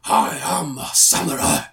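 samurai. Retold or imitated. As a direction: play the stoic samurai. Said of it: "you know what they replaced them with".

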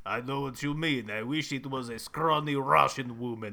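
put-on deadpan Russian agent accent; wistful Russian spy, accent throughout; mock Russian accent. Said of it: "honestly pretty good accents".

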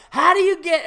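loud, high coach yell; demanding coach. Retold or imitated. Coach repeating his question to the kid, loud demand. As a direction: holler the question. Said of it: "And he goes".

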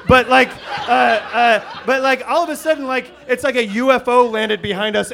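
ordinary talk, nothing marked

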